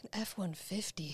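breathy longing whisper. Real, not acted. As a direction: whisper it like a dream. Wistful whisper about dream truck.

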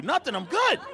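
high, unconvincing brush-off; comic touchy denial; feigned fine. Retold or imitated. Denying the problem, fishing for attention.

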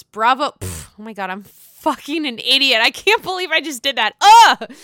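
ordinary delivery

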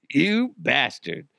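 mock outrage. Acted outraged reply to Connery.

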